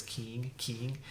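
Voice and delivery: Cork Munster "keen" ending in an ng sound; Cork accent. Shown as a demonstration. Teacher imitating the Cork accent on "keen". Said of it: "in the Cork version of Munster".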